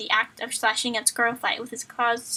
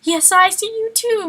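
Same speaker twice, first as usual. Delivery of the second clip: villain cat; 8 semitones higher, 5 dB louder, slower than usual. Acted.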